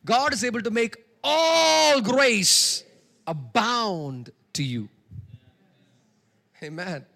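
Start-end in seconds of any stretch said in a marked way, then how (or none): 0.0-4.9 slow, loud, ringing preacher proclamation; preaching at full force. Shown as a demonstration. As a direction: stretch the verse out loud like a pulpit cry. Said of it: "Let's do it one more time".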